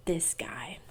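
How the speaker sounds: hushed conspiratorial aside; whispered aside. Real, not acted. Narrator whispering about the villain. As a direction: drop to a whisper for this guy.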